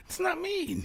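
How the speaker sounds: squeaky high protest; high denial. Acted. Will Rock denying it, high and defensive. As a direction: deny it in a high voice.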